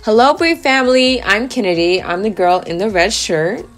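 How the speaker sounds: ordinary talk, nothing marked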